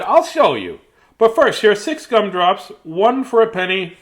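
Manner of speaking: shopkeeper voice. Acted.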